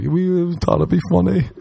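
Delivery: put-on high-pitched child's excuse; mock children's voice, pitched well up; imitated kids. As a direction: pipe up like a kid explaining a prank. Voicing the two kids' excuse.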